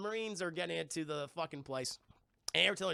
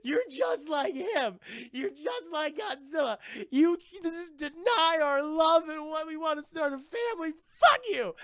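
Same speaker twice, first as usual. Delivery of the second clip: higher, tearful shouting that builds to a scream; crying rage, building; 8 semitones higher, 6 dB louder, slower than usual. Retold or imitated. Monster mother screaming at her betrayer, tearful fury building. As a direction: scream at a cheating mate, tears and fury rising. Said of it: "she's like".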